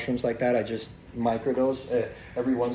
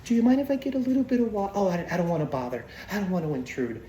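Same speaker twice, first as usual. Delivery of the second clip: high, fast fragile little-lady voice; timid mom impression; 6 semitones higher, faster than usual. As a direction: play the meek sweet old mom.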